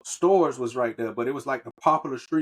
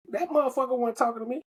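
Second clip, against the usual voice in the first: high incredulous protest; 8 semitones higher, faster than usual. Retold or imitated. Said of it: "I'm like".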